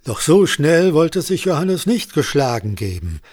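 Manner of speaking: ordinary delivery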